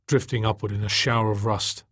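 ordinary delivery

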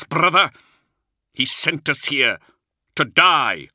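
high, loud, frantic outburst, drawn out; edge of madness. A narrator's reading. Luther revealing they were sent to die, half-mad. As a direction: let the voice rise toward raving. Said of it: "His voice rose, teetering on the edge of madness".

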